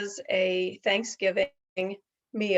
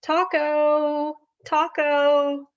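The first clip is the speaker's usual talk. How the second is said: higher, louder call for Taco; 7 semitones higher, 6 dB louder, much slower than usual. Real, not acted. Calling the puppet Taco out to play, louder call.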